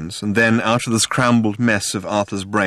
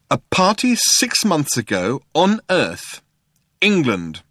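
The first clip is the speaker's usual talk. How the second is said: slow, pitched-up, pointed insistence; undeterred; 6 semitones higher, slower than usual. A narrator's reading. Arthur reminds Zaphod of the party, undeterred. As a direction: press each detail deliberately. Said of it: "Arthur would not be deterred".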